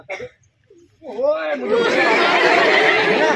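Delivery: mock anger. Teacher acting out getting angry for the class, with a laugh. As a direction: play-act losing your cool, half laughing.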